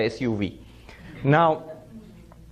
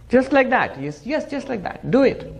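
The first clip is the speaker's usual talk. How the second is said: higher, eager coaxing voice; mock demonstration; 7 semitones higher than usual. Gentleman coaching the washerman, eager and higher. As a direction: show him how to pretend, eagerly. Gentleman showing how to pretend.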